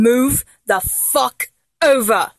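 slow, emphatic command; stern order. Acted. Fan giving idol final warning, stern slow order. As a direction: space out each word as a final warning. I'm not gonna tell you one more time.

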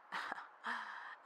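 quiet, high breathy laugh; small amused laugh; soft chuckle. Acted. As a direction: chuckle knowingly under the breath. Narrator chuckles within the story.

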